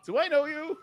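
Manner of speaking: high, loud startled greeting; surprised fan. Retold or imitated. Listener at the conference recognises the podcast host, high surprised call. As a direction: play a stranger suddenly recognising a familiar voice.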